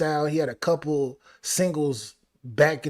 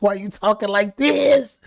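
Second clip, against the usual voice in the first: louder, slow mock complaint; mock plea; 5 semitones higher, 6 dB louder than usual. Real, not acted. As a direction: playfully scold the rapper. Mock scolding the rapper.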